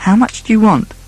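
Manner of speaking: high, feeble question; weak. A narrator's reading. Pale Marquis to his blackmailers, weak. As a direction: give in weakly, pitched high. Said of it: "he asked weakly".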